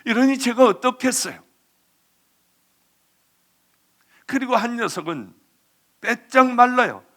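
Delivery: loud, high-pitched pulpit delivery, driving each line home; forceful preaching. Acted. Pastor telling the congregation about the boys, loud and preaching.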